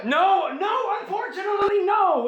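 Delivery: real outcry at a toy never made; exasperated shout. Real, not acted. Telling the co-host there was no such toy, loud exasperated protest.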